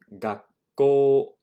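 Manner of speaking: 学校 with the final O stretched out; long O. Shown as a demonstration. Teacher showing the class the long vowel, drawn out. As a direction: hold the last O of 学校 long. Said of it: "we elongate the O sound".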